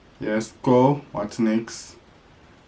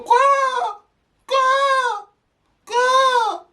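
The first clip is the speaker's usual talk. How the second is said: peacock call; much slower than usual. Shown as a demonstration. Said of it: "And go".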